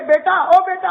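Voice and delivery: mother calling out high to her son. Retold or imitated. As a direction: call the boy like a loving mother. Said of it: "وہ ماں کہتی ہے".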